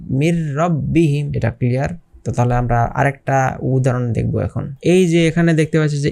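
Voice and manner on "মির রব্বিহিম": মির রব্বিহিম slow, n merged into a doubled r; merged without ghunnah. Shown as a demonstration. Teacher models idgham, মির রব্বিহিম slow with doubled r, no hum. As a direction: run মির straight into a strong rolled r in রব্বিহিম. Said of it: "এভাবে মুখস্থ করতে হবে".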